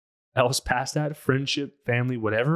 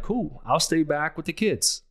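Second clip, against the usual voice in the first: higher, lightly breezy supportive spouse; carefree spouse; 6 semitones higher than usual. Retold or imitated. Spouse happy to stay home, higher carefree voice. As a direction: toss it off easily, no worries at all. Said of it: "and you're like".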